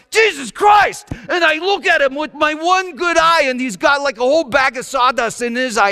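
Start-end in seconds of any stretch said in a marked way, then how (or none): 0.1-1.1 sudden high shout of pain; pained yell. Retold or imitated. Father yelling as his son gouges his eye, a sharp pained shout. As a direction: yell it out like your eye just got gouged. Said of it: "I remember I yelled".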